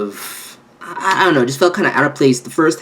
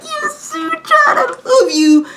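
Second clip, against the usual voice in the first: high, slow, tearful mock voice for the character; a high put-on character voice; slower than usual. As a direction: squeak it out like a sobbing fan. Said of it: "he's like".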